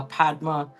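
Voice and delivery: ordinary talk, nothing marked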